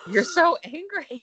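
loud, high mock accusation; hammed-up Rinna line. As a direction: belt the famous line at her. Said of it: "As Lisa Rinna would say".

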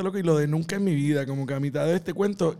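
ordinary talk

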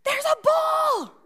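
high, loud call across the stands; dad calling out to William in the stands, loud and high; yelling. Retold or imitated. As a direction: shout to the boy so he hears. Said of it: "And he yells".

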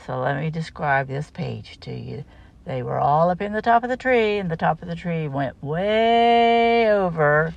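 ordinary delivery

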